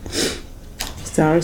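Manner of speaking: whispered sorry; breathy whispered apology. Real, not acted. Whispered apology to her sister.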